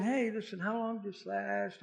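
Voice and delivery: very soft, hushed casual question; calm voice. Retold or imitated. Jesus walks in calming everyone down, very soft calm voice. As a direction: ask it quietly, easing everyone down. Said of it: "speaking in a very calm voice".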